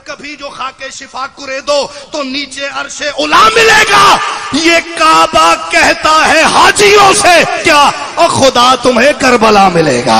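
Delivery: verse declaimed, building to a loud shout; poem. Real, not acted. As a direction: stretch the lines out at full force. (बुलंद आवाज़ में शेर-ख़्वानी)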